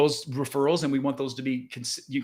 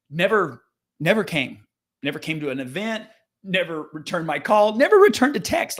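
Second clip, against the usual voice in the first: stack the nevers until the last one peaks; building emphasis on never; 2 semitones higher, 3 dB louder than usual. Retold or imitated. Listing unanswered outreach, building frustration.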